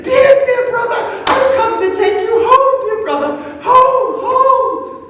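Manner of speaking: a small sister calling her brother home, high and eager; little girl's voice. A narrator's reading. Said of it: "And she said".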